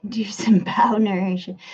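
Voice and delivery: unvoiced whisper of the chat line. Shown as a demonstration. Streamer whispering a chat message to viewers. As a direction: breathe the words without voice. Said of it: "did I nail it?".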